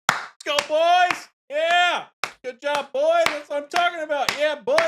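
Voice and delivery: hollering like a frat bro at the game. Retold or imitated. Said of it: "Michael would be going".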